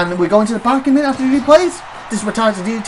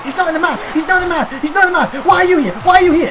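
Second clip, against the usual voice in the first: high-pitched indignant mock protest; retold protest; 7 semitones higher than usual. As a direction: replay your own ringside outcry, pitched high. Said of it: "I was like".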